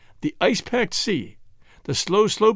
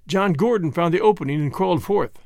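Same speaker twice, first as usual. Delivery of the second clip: usual speaking voice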